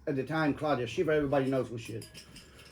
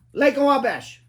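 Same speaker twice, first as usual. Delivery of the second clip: loud, high curt order of a destination; curt demand; 9 semitones higher, 8 dB louder, slower than usual. Retold or imitated. Rude passenger barking at the cab driver, curt and loud. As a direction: bark the place name like an order.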